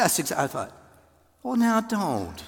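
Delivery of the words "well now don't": mild, higher playful God voice. Retold or imitated. God voiced in retold story.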